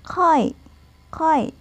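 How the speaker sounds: teacher demo of khói, high and crisp; modelled khói. Shown as a demonstration. Teacher repeats khói for learners, pitched high and careful. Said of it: "đây sẽ đọc là khói".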